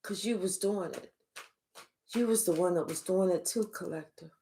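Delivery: hushed accusing reply; quiet retort. Retold or imitated. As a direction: say it under your breath, knowingly. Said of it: "They're like".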